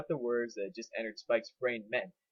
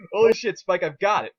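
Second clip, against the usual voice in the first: excited outburst; 6 semitones higher, 10 dB louder than usual. A narrator's reading. Sudden idea excitement.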